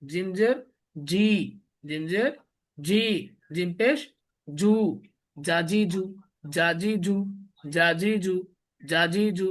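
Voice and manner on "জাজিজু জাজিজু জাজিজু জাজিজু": জাজিজু with hard, firm জ sounds; hard jim. Shown as a demonstration. Teacher drilling students on a hard জ, firmly. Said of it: "শক্ত করে।".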